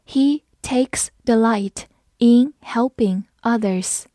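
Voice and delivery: slow model reading, word by word; slow read-along. Shown as a demonstration. Teacher modelling a sentence for learners, slow and separated. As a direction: leave a gap after each word, slowly. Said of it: "好，我们慢读一遍".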